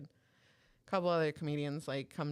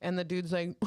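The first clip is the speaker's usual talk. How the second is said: ordinary talk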